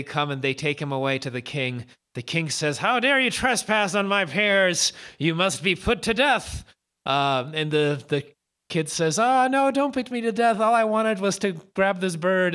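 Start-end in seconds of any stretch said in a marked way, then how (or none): 2.8-6.6 angry king. Acted. King scolds trespassing boy. 9.2-12.5 put-on pleading boy. Boy begs king for life.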